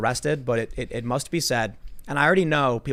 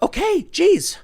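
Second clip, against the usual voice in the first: very high, louder mock give-in; exasperated friends; 5 dB louder, much slower than usual. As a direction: cave in with a squeaky sigh of defeat. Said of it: "And they're like".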